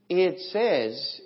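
ordinary talk, nothing marked

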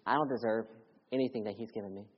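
ordinary delivery

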